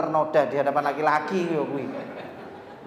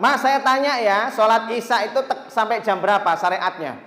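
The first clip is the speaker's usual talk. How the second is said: higher, louder challenging boss; boss questioning; 5 semitones higher, 5 dB louder than usual. Retold or imitated. Boss quizzing worker on prayer deadline, higher and louder. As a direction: quiz the worker smugly. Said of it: "Kata si bos".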